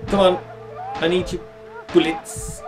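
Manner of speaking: usual speaking voice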